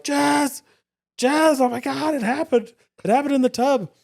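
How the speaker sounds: high, urgent calling out; alarmed call for the partner; retold panicked call, pitched high; mock panic.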